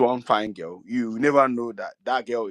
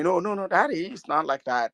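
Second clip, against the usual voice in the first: imitating his children; 9 semitones higher than usual. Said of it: "they will tell me".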